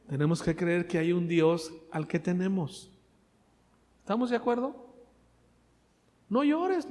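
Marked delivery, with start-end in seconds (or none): 6.3-6.9 "No llores" lifted high, drawn out; high pleading "No llores". Acted. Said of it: "le dijo".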